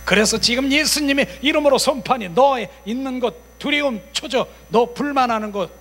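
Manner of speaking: loud, high declaration; forceful casting-out. Acted. Preacher naming the spirits within, forceful casting-out. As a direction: call each one out with authority.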